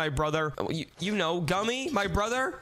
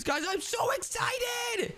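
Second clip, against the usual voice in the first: high, stretched excited squeal; overjoyed, very high-pitched gushing; bursting excitement; slower than usual. Acted. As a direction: squeal it out slowly with glee. New video to watch.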